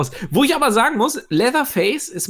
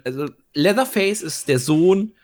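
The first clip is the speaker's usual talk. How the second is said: usual speaking voice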